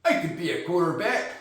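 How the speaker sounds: lightly attempted Utah accent. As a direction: try a mild, unsure Utah accent. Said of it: "how do they talk?".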